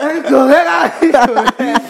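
"goreng" said as "jole", high; lisping with a laugh. Retold or imitated. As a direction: say "nasi goreng" with l for r. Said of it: "Pelate jiwit".

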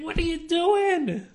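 high, loud, outraged yell; irate shout across the street at night; angry neighbour shout. Acted. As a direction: yell it out of a window at someone. Neighbour yelling out of window at night.

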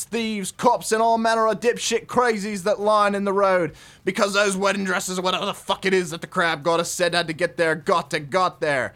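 hammed-up, sweary Aussie rules reading; put-on broad Australian accent, punching the swear words; bad Aussie accent. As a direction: read it out like a crude Australian trucker. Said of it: "do it in Aussie accent".

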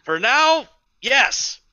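high, loud curt reply; curt squatter. Acted. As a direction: clip it off. NPC answers.

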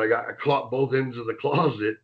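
ordinary talk

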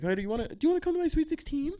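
put-on high, fast, eager girl's invitation; chirpy high-pitched inviting girl; mock girl voice. Mimicking a girl inviting him to her party.

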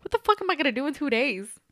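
normal talking voice

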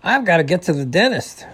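impatient, moderately loud yell. A narrator's reading. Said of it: "yelled the beaver".

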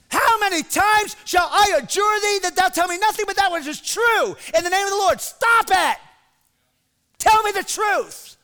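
high-pitched exasperated shout; ticked off. Retold or imitated. King ticked off at Micaiah's mocking answer, angry shout. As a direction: demand it at the top of your voice, fed up. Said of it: "he got ticked off".

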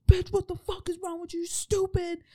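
hammed-up outraged partner; harsh partner yelling. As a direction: play the nasty partner berating her. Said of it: "someone else might be like".

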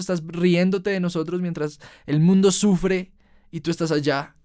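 high, loud, accusing outcry; angry shout at God. Retold or imitated. Furious at God for letting the world suffer, shouting. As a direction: rage at a God who stays away. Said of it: "le grité".

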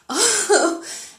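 short high burst of laughter. Real, not acted. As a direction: laugh at her own cheeky ask. Laugh after asking for cash.

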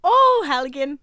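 put-on loud, botched Irish accent; bad Irish accent. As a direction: have a go at Irish, badly. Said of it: "I nailed the Irish accent".

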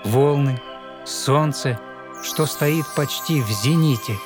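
normal talking voice

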